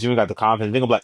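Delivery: usual speaking voice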